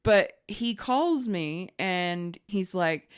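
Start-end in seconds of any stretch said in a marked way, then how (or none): none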